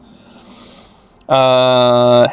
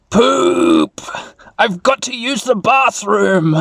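slow, high cartoon creature voice; hammed-up creature urgency; Agumon voice; 6 semitones higher, slower than usual. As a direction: announce it drawn out, like a needy little creature. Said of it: "Agumon says".

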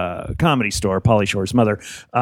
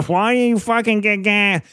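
mock cussing out; 9 semitones higher, slower than usual. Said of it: "cussing me out".

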